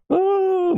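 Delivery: very high sustained "ah"; hammed-up falsetto shriek; mock scream. Streamer acting out the Kraken attack, high mock scream. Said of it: "screaming my head off".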